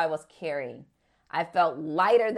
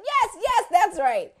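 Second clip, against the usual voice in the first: loud, high retold exclamation; excited recognition; 11 semitones higher, 6 dB louder, slower than usual. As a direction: act out his delighted recognition. Friend hears the name, exclaims.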